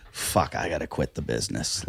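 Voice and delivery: low, soft defeated mumble to himself; retold deflated resignation; dejected. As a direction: give up quietly, pitch sunk low. Retelling feeling outclassed by comic.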